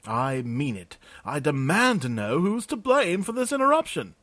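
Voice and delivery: high, loud, prissy outrage; the pompous clerk puffs himself up and insists; indignant demand. Acted. Clerk Jurgen demanding someone take the blame, high and loud.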